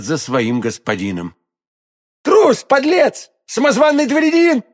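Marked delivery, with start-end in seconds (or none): 2.2-4.6 furious shout. A narrator's reading. D'Artagnan chasing the fleeing stranger, shouting. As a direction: hurl the insults after him at full voice. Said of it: "закричал Д'Артаньян".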